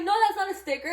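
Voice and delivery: high, loud, hurried denial; retold alarmed correction. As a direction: cut the kids off in alarm.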